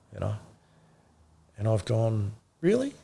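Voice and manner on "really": a very high, short, rising really; a hint of surprise at a colleague's plan; surprised really. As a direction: shoot the pitch up in disbelief. Incredulous reply to a colleague.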